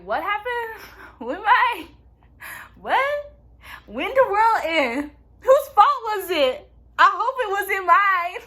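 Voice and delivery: high, bewildered mimicking voice; hammed-up innocent confusion; mock clueless amnesiac.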